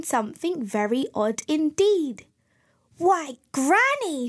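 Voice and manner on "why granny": high, drawn-out storybook astonishment; gasped surprise. A narrator's reading. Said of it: "she gasped".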